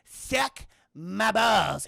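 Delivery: "Suck" alone, then "my balls" loud and drawn out; deliberate pause. Retold or imitated. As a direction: drag out "Suck my balls" with a gap after "Suck". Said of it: "it's the pause".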